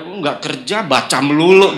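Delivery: annoyed wife. Retold or imitated. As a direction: lightly act out a wife's complaint, punching the ending. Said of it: "kayak marah gitu ya".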